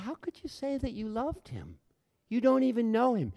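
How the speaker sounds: high-pitched, retold disbelief; incredulous questioning. Speaker questioning his friend about loving a stranger, incredulous. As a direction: challenge her, voice lifted high in disbelief.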